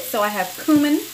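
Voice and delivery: ordinary talk, nothing marked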